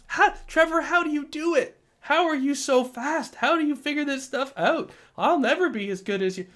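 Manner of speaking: high, whiny imitation of a student; hammed-up pleading admirer; mock awestruck student. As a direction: gush helplessly in a high put-on voice. Mock awestruck student addressing the teacher.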